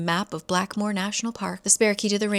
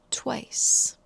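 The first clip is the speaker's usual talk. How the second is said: dramatic whisper; 4 semitones higher than usual. Retold or imitated. Dramatic reveal of the legend.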